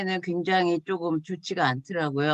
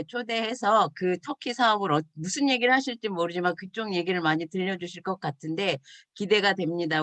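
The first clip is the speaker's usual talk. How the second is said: normal talking voice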